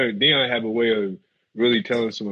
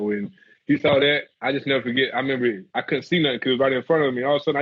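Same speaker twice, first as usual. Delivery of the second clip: usual speaking voice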